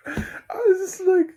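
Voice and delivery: laughing. Real, not acted. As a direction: break up in helpless laughter. Overcome laughing about the horse.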